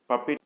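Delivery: पपेट ending in a short "it". Shown as a demonstration. Teacher modelling the right sound, पपेट with short-i ending. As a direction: say पपेट as "puppit". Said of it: "ई की आवाज़ निकालेंगे".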